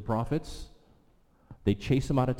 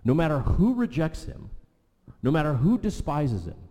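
higher, louder preaching rising to a climax; preaching build; 5 semitones higher, 3 dB louder than usual. Real, not acted. Preacher assures the congregation Jesus never changes, rising fervour.

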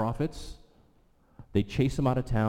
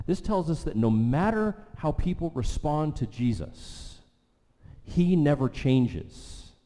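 higher, louder preaching rising to a climax; preaching build; 5 semitones higher, 3 dB louder than usual. Real, not acted. Preacher assures the congregation Jesus never changes, rising fervour.

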